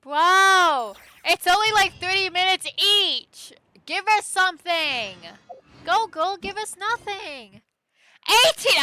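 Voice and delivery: high, very loud exclaiming; exasperated outburst. Real, not acted. Streamer reacting to how long the chapters take, loud exasperation. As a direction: yell it in loud, high disbelief.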